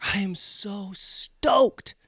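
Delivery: breathless strained whisper. Real, not acted. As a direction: whisper it through the burn. Deadpan whisper while in pain.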